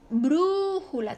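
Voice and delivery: slow Brújula with a long BRÚ; stretched stressed syllable. Shown as a demonstration. Teacher showing the stress, lingering on BRÚ in Brújula. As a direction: draw out the first syllable of Brújula. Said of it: "su sílaba tónica está en la antepenúltima".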